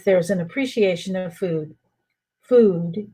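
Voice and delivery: ordinary delivery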